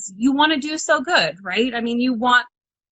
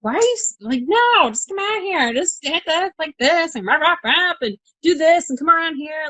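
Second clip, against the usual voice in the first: higher-pitched mock impatience of fellow drivers; imitating male drivers; 7 semitones higher than usual. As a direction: lightly act out a buddy brushing off worries. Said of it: "between women and men, but they're like".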